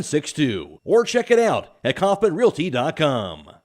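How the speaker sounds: upbeat local radio commercial voice; ad read. Acted. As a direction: sell it like a radio spot. Announcer reads sponsor ad.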